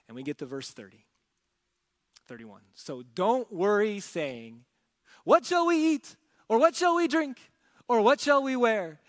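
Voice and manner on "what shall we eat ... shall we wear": high, loud fretting questions; anxious worrier. Acted. Preacher voicing a worried crowd, high frantic asking. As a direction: ask like someone panicking about everything. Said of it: "give you the flavor of how these questions are being asked".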